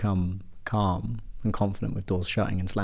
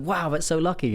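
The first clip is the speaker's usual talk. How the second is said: much higher mock amazement; impressed villagers; 8 semitones higher than usual. Villagers to the old man about the horses, impressed. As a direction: lightly play villagers in wonder.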